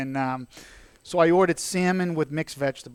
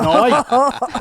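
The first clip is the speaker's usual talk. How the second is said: indignant protest; 7 semitones higher, 7 dB louder than usual. Retold or imitated. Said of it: "And I said".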